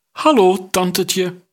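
put-on high, impish boy's greeting; cheeky boy. A narrator's reading. Boy greeting his aunt the queen cheekily. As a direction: play a cocky young boy teasing his aunt.

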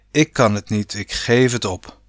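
ordinary delivery

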